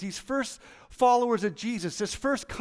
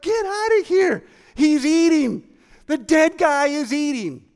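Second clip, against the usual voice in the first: high, louder amazed outburst; giddy disbelief; 5 semitones higher, 6 dB louder than usual. Retold or imitated. Disciples watching the risen Jesus eat, in loud giddy amazement. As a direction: shout out that he's eating. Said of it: "they're like".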